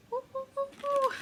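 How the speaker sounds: high hooting owl voice; high cartoon owl hoots. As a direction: hoot like the cartoon owl Archimedes. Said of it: "reminds me of the owl in Merlin".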